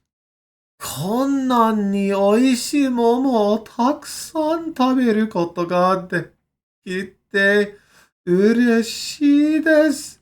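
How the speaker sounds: high, frothing excitement over the peaches; overjoyed Japanese gushing; excited Japanese accent. Shown as a demonstration. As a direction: gush it like you're frothing at the mouth. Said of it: "you have to say it in that accent".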